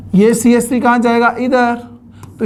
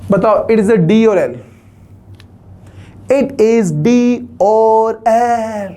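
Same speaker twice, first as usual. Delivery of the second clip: drawn-out quiz; slower than usual. Acted. Teacher drawls out the quiz question.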